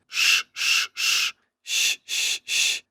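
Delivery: "Sh" as a long soft unvoiced hiss; a drawn-out unvoiced "sh"; hissed sh sound. Shown as a demonstration. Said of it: "it's the same sound".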